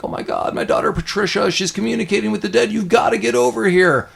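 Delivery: put-on high, fast, panicked old lady on an answering machine; high-pitched hammed-up old woman calling in a panic; frantic old woman. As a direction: rush the message like a terrified old mother. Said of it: "the old woman from the house being like".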